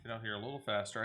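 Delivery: ordinary talk, nothing marked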